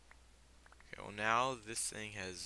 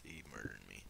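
sulky low grumble; 11 semitones lower, 9 dB quieter than usual. Real, not acted. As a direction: lower and softer mock-tragic. Complaining about dying.

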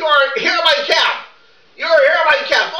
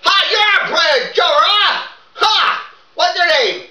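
full-voice boot camp shouting, rehearsed; rehearsed drill-sergeant yelling; 2 semitones higher than usual. Pretend. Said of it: "you don't have to yell it".

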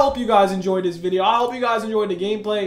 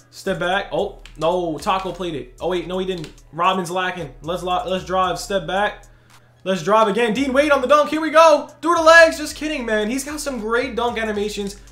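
usual speaking voice

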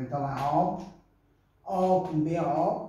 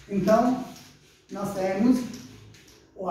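ordinary talk, nothing marked

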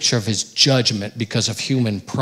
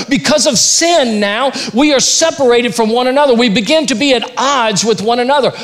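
raised, loud preacher's delivery; forceful preaching; 9 semitones higher, 5 dB louder than usual. Acted. Preacher warns the church about sin, full force. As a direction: drive the point home to the congregation.

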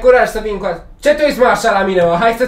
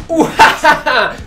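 gloating villain laugh; 2 semitones higher, slower than usual. Pretend. Fighter gloating over his secret technique, hammed-up cackling.

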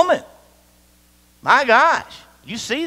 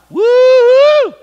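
a much higher, louder leering whoop; mock wolf whoop; 12 dB louder than usual. Preacher mimicking a man ogling a woman, a loud hammed-up whoop. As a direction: ham up a guy ogling a woman. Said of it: "you stop looking at her like".

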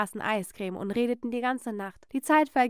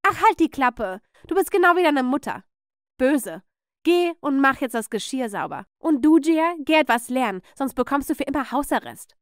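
loud, raised orders; irate aunt dishing out punishment; angry scolding; 3 semitones higher, 5 dB louder than usual. Acted. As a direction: shut them up and send them off. Aunt orders children away.

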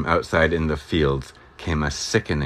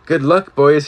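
high, loud farewell cry; a narrator's cry, pitched up; cried out; 9 semitones higher, 6 dB louder than usual. A narrator's reading. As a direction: call it out to the departing barbaloots. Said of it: "he cried".

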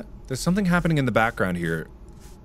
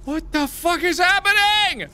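shocked disbelief; 3 dB louder than usual. Real, not acted. Game gets bizarre.

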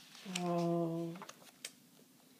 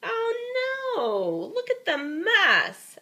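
mock dismay; 13 semitones higher, much slower than usual. Dismay at jacket mess.

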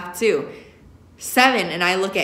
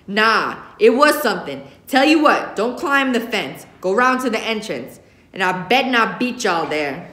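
angry scolding; 3 semitones higher, 4 dB louder than usual. Acted. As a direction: lay down the law, still heated. Father lecturing angrily.

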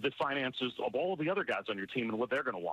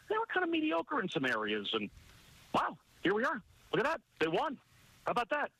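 much higher, mock-amazed sarcasm; sarcastic surprise; 11 semitones higher than usual. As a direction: play dumb about the win, dripping irony.

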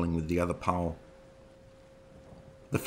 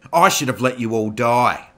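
loud, higher bitter yell; furious scream; 5 semitones higher, 11 dB louder than usual. Acted. Prisoner screaming at the interior guard, enraged. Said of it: "Roy screamed at the interior guard".

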